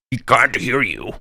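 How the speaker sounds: slow, drawn-out talking baboon; garbled baboon voice. Acted. Baboon answers the praying adventurer, garbled creature voice. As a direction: speak slowly as a mustached baboon. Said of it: "what you hear instead is that mustache baboon in, uh, garbled Arcantian".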